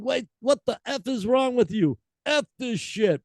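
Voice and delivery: high mock Scottish scolding; furious singer. Host reads singer ordering girls to stop, high mock scold. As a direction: tell the crowd off in a high put-on voice. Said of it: "she began".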